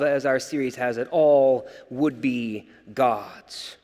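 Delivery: usual speaking voice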